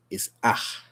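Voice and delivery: A said as a short open ah; short vowel A, ah. Shown as a demonstration. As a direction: say A as ah with an added H. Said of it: "the short sound for A".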